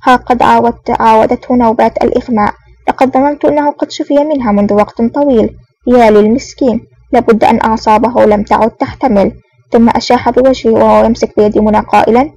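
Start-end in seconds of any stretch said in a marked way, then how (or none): none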